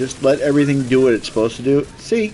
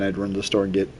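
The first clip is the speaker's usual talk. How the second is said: ordinary talk, nothing marked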